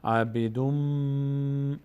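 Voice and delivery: عبیدوم with the final "m" hummed long through the nose; slow tajweed ghunnah on the end of عبیدوم; nasal held mim. Shown as a demonstration. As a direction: hold the "mmm" of عبیدوم.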